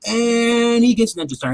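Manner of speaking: ordinary delivery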